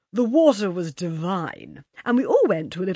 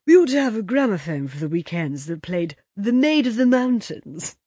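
loud, higher theatrical lament; tragic moan; 4 semitones higher, 8 dB louder than usual. A narrator's reading. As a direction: moan it like a tragedienne. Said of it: "A tragic moan from Moira".

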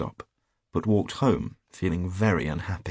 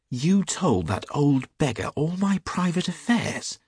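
high-pitched, angry disbelief; indignant cry; 9 semitones higher, 3 dB louder than usual. A narrator's reading. Hughie accusing his friend of spilling secrets, indignantly crying out. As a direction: cry out, scandalised and cross. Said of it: "cried Hughie, looking very red and angry".

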